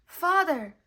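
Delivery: loud high exclamation; declared. A narrator's reading. Pinocchio greeting his father inside the whale, loud declaration. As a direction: announce yourself proudly. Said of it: "declared Pinocchio".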